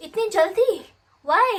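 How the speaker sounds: sudden high, loud cry of disbelief; shocked disbelief. Retold or imitated. Telling viewers how she reacted to the transfer, shocked and loud. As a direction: blurt it out, stunned he's leaving so soon.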